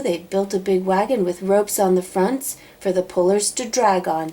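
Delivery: plain reading voice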